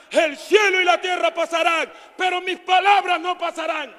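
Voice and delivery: loud, fervent shouted preaching; full-voiced revival preaching; preaching at full force. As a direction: cry it out to the congregation.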